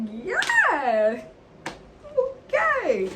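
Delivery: high, squeaky, loud mock answer; flustered reply. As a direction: answer high-pitched, as if caught off guard. Acting her flustered answer to him.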